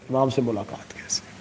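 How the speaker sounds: whispered aside to the congregation; hushed whisper. Acted. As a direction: drop to a whisper on the question. Preacher drops to a hush.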